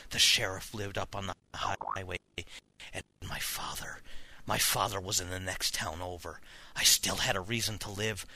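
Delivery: low, subdued narration; quiet story ending. A narrator's reading. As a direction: wind the tale down softly to a hushed close.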